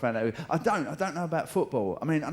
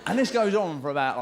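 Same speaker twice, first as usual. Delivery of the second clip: ordinary talk, nothing marked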